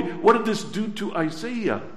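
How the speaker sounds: normal talking voice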